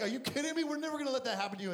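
excited Peter objecting to Jesus; Peter protesting. Retold or imitated. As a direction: blurt it out in alarm.

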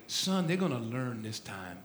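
low, hushed fatherly voice; quiet, deep fatherly confidence to a son; God as father. Retold or imitated. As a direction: drop down soft and deep, confiding. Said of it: "His daddy goes".

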